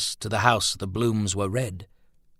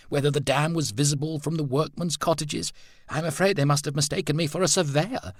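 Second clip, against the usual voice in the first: slightly raised pitch, mildly exasperated chatter; a lady complaining about the police questions, politely; lightly voiced lady, mildly put upon; a lady guest, lightly voiced; 7 semitones higher than usual.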